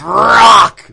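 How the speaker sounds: long loud ringside echo; hype echo shout. Pretend. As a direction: belt the name out slowly. Cohost echoes name hyped.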